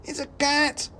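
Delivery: very high squeaking alarm; a crowd of mice shrieking in fright; panicked mouse cry. Acted. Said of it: "the cry went up".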